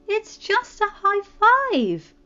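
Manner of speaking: high, slow, wheedling plea; pleading Poppy voice. Acted. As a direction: coax him sweetly. Poppy coaxing.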